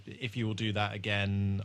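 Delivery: ordinary talk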